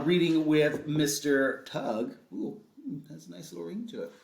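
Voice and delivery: ordinary delivery, nothing marked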